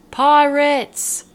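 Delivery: loud high alarm cry of pirates; lookout's shout. A narrator's reading. Lookout on the mast warning the sleeping crew, loud shout.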